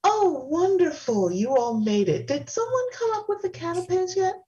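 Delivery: high, airy, dainty hostess; delicate femme voice. Acted. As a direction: gush sweetly like a dainty socialite. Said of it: "trots in delicately".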